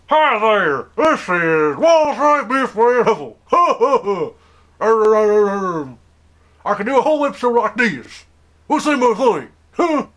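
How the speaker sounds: high, loud and slow put-on silly voice with laughter. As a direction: overdo a goofy high character. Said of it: "mess up my accent".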